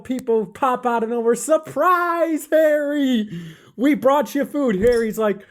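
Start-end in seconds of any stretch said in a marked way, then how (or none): 1.4-4.8 high, bright cheer; surprise shout. A narrator's reading. Villagers jumping out at Harry with food, excited. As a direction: pop out and yell surprise.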